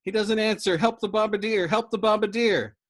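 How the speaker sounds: desperate high yell over the intercom; frantic shout. Acted. Dobbs calls the crew in panic, shouting. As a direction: scream for help into the radio.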